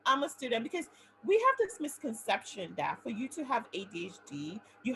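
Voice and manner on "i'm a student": higher, scoffing brush-off; retelling her own denial of the diagnosis, higher and scoffing; dismissive denial. Retold or imitated. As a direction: wave it away, pitched up. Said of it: "I was like".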